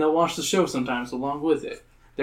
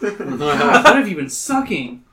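laughing disgust; 4 semitones higher, 4 dB louder than usual. Acted. Mom disgusted by breath.

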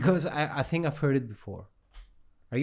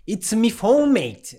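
higher, louder mock British dialect, "me" for "my"; British "me phone" impression, pitched up; imitated British dialect; 7 semitones higher, 5 dB louder, slower than usual. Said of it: "You're not even saying it".

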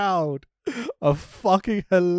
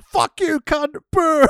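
crowd heckling; 12 semitones higher, 7 dB louder, slower than usual. Retold or imitated. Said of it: "And the crowd are like".

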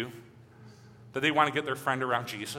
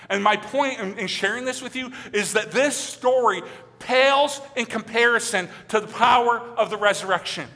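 louder, rising sermon voice with slow emphatic refrains; preaching at full force; 8 semitones higher, 7 dB louder than usual. Acted. Pastor urging his congregation toward grace, fervent preaching. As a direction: drive each line home to the congregation.

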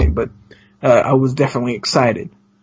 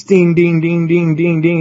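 ringing dings on one raised note; imitated signal bell; 3 semitones higher than usual. Guest describing a UFO over her house, chanted bell dings. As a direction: chime like a flashing railroad signal. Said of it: "You know how it's like ding".

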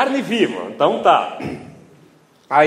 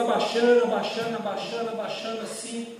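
fading softer with each repetition; hushed fading; 10 dB quieter than usual. Retold or imitated. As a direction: let the repeats sink away to very quiet. Man slowly bending down to him.

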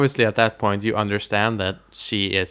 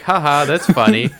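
higher, louder amused chuckle through the words; laughing; 4 semitones higher, 5 dB louder than usual. Retold or imitated. Viewer amused by the dad's outburst, laughing.

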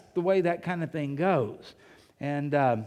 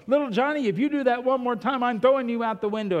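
higher, louder mock parental threat; threatening parent; 6 semitones higher, 4 dB louder, faster than usual. Parent threatens little Johnny with the window, mock scolding. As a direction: act out an exasperated parent's warning.

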